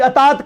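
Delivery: "اطاعت" starting with an a, "ataat", run together; common wrong form. Shown as a demonstration. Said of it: "یہ لفظ اطاعت ہے".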